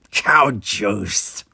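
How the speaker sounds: mild mock horror at milk. A narrator's reading. Nog reacts to Jake ordering a malted, mild mock horror. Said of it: "he said in horror".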